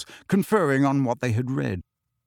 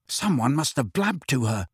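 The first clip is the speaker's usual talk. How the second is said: plain reading voice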